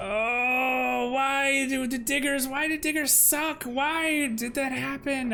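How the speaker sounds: high, pained cry of frustration; frustrated wail. Real, not acted. Gamer wailing high at his useless diggers, frustrated. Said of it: "I'm so angry right now".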